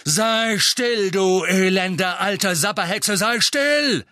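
loud, furious roar; thundering. A narrator's reading. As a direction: bellow it down at her. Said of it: "donnerte er".

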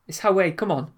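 a casual Geordie prompt; Geordie accent. Shown as a demonstration. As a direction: coax him along like a Newcastle mate. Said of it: "Seven, Geordie".

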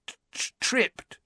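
high, stammered shaky voice. A narrator's reading. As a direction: stutter out the word, trembling. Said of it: "said Dudley shakily".

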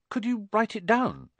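put-on high, tentative boy's voice; Harry. A narrator's reading. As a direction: offer a helpful suggestion. Harry makes a helpful suggestion.

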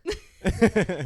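big burst of laughter; loud laugh. Real, not acted.

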